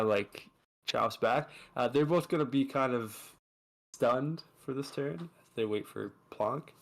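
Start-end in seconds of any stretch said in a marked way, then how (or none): none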